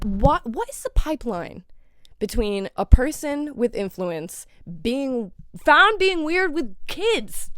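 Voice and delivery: ordinary delivery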